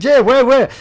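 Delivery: high, loud mock French exclamation; excited confirmation. Speaker confirming his name to the Frenchman, excited and loud. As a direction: cry it out in eager put-on French.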